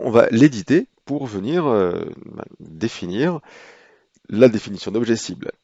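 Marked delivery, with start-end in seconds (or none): none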